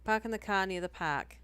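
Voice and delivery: hammed-up Boston accent; thick Boston-style accent. Shown as a demonstration. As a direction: drop every R like a Bostonian. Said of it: "stop with the accent".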